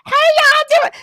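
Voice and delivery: mentor's take on the loud sassy bit; sassy character shout. Retold or imitated. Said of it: "'cause he was like".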